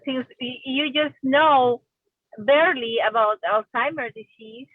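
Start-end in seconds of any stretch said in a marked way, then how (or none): none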